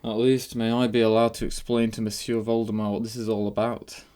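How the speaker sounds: dry doctor with a put-on voice; sarcastic. A narrator's reading. Doctor asking to explain the game, sarcastic. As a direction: explain with mock-polite sarcasm. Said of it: "began in a sarcastic voice".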